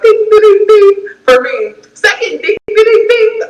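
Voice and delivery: high sung-out dings; playful high bell dings; ding sound effect. Shown as a demonstration. Counting red flags with dings.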